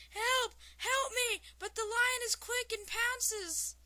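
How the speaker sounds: high, loud panicked yell; screaming for help. A narrator's reading. Mime fleeing a lion in a joke, screaming for help. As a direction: yell it out high like someone being chased. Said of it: "the mime starts screaming, yelling".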